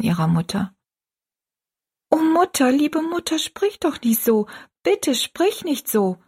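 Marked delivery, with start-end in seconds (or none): 2.1-6.2 put-on high child's voice, begging; pleading young daughter. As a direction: implore your mother in a high girlish voice. Daughter begs dying mother, then weeps.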